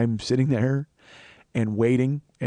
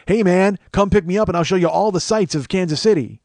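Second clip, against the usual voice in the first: imitated cheery offer; 6 semitones higher, 4 dB louder than usual. Voices a cheery Twitter stranger.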